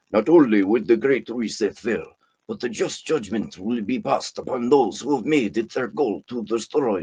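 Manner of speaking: lightly voiced preachy prophet; a touch of a self-styled prophet proclaiming; polemical prophet. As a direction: read it like a mild prophetic sermon. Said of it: "polemical he's sounding here".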